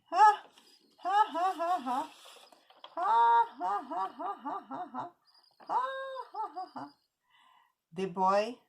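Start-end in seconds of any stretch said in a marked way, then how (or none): 0.1-6.9 high, acted peals of ha-ha; hammed-up laughing that starts loud and tapers; mocking laughter. The animals laugh at the bee, mock laughter. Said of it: "laughed at the bee".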